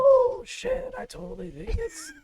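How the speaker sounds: high excited gasp of realization; surprised. Real, not acted. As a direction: blurt it out in shock. Sudden realization.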